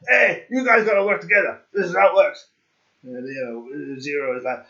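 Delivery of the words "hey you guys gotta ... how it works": bossy captain. Pretend. Captain orders the cops.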